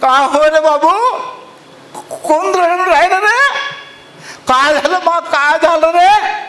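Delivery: high, loud worried call from afar; old woman calling out. Acted. Old mother calls out to her weeping son. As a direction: shout across the house to her son. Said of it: "म्हातारी उठून तिथून ओरडली".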